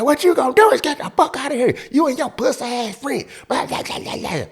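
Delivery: very high, fast mock female screech; put-on shrieky tirade into babble; going to town. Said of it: "just going to town being herself".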